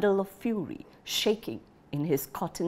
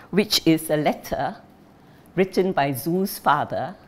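plain reading voice

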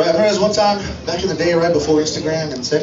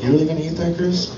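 judgy roach voice; 3 semitones lower, 4 dB quieter than usual. Retold or imitated. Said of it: "the roach comes out and he's like".

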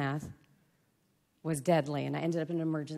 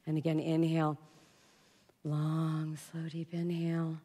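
slow breathing cue. Shown as a demonstration.

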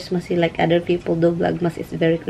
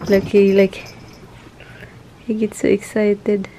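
ordinary talk

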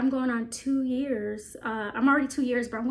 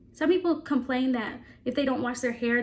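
ordinary talk

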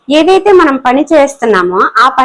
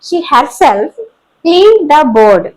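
slow dictation; much slower than usual. Shown as a demonstration. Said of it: "teacher reads example sentence".

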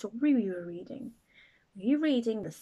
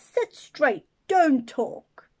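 a touch of a stern teacher, louder; strict teacher; 3 semitones higher, 7 dB louder, slower than usual. Acted. Teacher ordering the class to sit still, mildly stern. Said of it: "telling the class".

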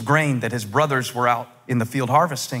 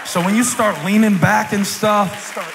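high, stretched pulpit delivery; animated preaching; 6 semitones higher than usual. Real, not acted. Preacher teasing the congregation about their body language, animated. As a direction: preach it out, lingering on phrase ends.

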